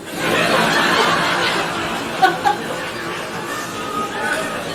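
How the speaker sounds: breathless wheezing laughter; doubled over with soundless laughing; silent helpless laugh. Real, not acted. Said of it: "sorry".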